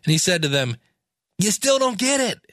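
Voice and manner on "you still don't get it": high, mock-exasperated teacher voice; playful put-on exasperation; exasperated Jesus. Jesus exasperated with slow disciples.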